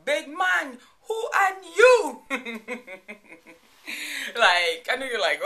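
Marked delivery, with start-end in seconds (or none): 0.1-2.2 loud, high mock challenge on "who are you"; sassy challenge. Shown as a demonstration. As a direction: throw it down like a playful dare. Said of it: "I still say it to this day".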